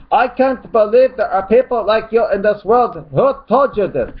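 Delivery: high, loud put-on disbelief; mock angry shout. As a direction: shout it high as the outraged commenter. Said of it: "he starts getting really angry here".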